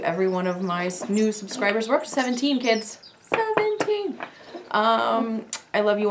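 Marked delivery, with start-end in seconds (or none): 3.2-4.2 much higher, thrilled repeat of the number; excited squeal. Real, not acted. Proudly telling her viewers her subscriber count, thrilled and high.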